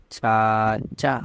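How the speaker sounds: very slow, each sound drawn out for students; slow reading demo. Shown as a demonstration. Teacher reading a new word aloud to students, very slow. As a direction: sound out the word slowly for the class. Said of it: "ये क्या हुआ?".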